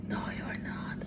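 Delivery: unvoiced whisper of a voice. The voices he hears whispering no. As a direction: breathe it out as a ghostly whisper. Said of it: "why they are shouting".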